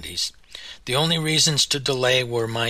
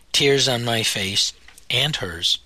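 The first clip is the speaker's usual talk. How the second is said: ordinary delivery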